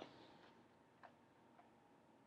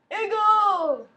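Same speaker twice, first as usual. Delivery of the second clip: eagle yell; 6 semitones higher, 8 dB louder than usual. Acted. Calls the trick name.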